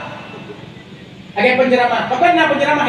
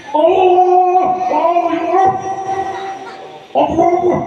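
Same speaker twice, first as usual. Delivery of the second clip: high, drawn-out cries; hammed-up high wail; mock outcry; 7 semitones higher, much slower than usual. Comic imitated cries. (seruan tinggi yang dibuat-buat)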